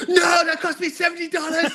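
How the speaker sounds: high, loud cry of protest; mock alarmed shout. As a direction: yell in mock horror at wasted money. Mock protest at pigment poured out.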